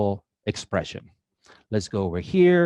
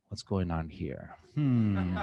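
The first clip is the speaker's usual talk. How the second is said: mock suspicious; 4 semitones lower, 8 dB quieter, slower than usual.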